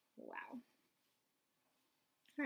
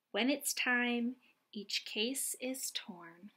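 bright, louder read-aloud storytelling voice; picture book reading; 3 semitones higher, 5 dB louder than usual. Acted. Reading a butterfly picture book to young children, lively and bright.

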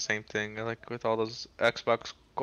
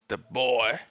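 lightly acted game-character voice, louder on "boy"; God of War catchphrase; 5 dB louder than usual. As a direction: put on a hint of a stern game father. Quoting God of War's catchphrase.

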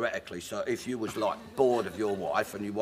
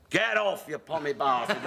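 mock heckle yell; 5 semitones higher than usual. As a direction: yell him off the stage. Crowd yells at the performer.

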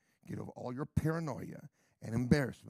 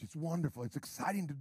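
ordinary talk, nothing marked

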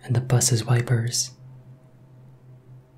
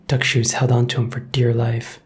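ordinary delivery

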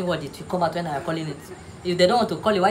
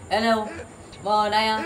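ordinary delivery

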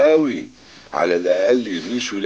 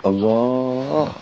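lovestruck sigh; much slower than usual. Acted. Sighs lovingly after hanging up.

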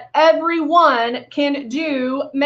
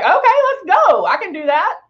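eager quote; 7 semitones higher than usual. Retold or imitated. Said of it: "We, we're like".

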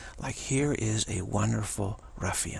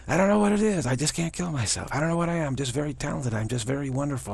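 preen like a cocky star; bragging Trump; 4 semitones higher than usual. Retold or imitated.

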